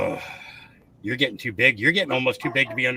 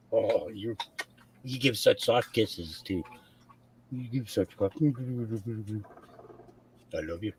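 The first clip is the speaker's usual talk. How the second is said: cooing, soft pet-talk voice, often much softer; 4 semitones lower, 4 dB quieter than usual. Real, not acted. Owner coos to her puppy on stream, soft baby talk.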